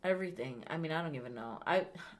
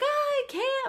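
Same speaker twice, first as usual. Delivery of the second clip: high-pitched mock wail; imitating panic. Scared kid refusing to walk out in rain, panicked wail. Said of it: "He was panicking".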